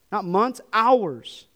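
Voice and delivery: high, loud, impassioned pulpit delivery; fired-up preacher building to a peak; full-force preaching. Acted. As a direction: preach it hard to the congregation.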